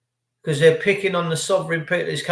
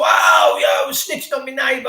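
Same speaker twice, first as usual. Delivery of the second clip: mock snitch shout; 5 semitones higher than usual. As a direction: shout it out like a gloating neighbour telling on you. Mocking a neighbour who snitches.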